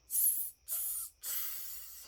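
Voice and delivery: breathy creature hisses; very soft unvoiced hissing tss. Gollum puzzling over the riddle, soft hissing. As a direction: hiss while thinking. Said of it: "said Gollum".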